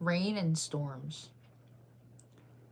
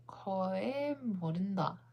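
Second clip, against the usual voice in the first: normal talking voice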